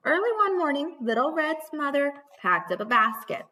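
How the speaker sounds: put-on bright, lively read-aloud for children; storybook narration. A narrator's reading. Teacher reading to her class, lively storybook voice. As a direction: read it like story time for little ones.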